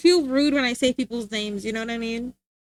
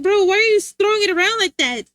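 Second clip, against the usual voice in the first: exasperated retort; 7 semitones higher, 6 dB louder than usual. Retold or imitated. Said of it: "I'm like".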